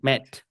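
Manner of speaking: "Met" with a short EH. Shown as a demonstration. Teacher reading the contrast word, short E. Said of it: "Porque es una E antes de una consonante final".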